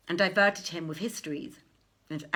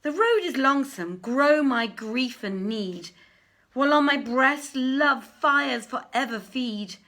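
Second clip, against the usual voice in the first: loud, high, slow lovesick verse; a prince crying out his love poem on the road; impassioned love lament; 4 semitones higher, 6 dB louder than usual. Acted. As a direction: pour the longing out as a loud lament. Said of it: "and he broke out".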